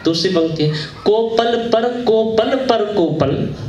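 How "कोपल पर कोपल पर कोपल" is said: high, slow rhythmic verse; poem recitation. Acted. Poet gives the next verse line to listeners, slow lilting recitation. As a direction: draw out each repeated word on a raised pitch.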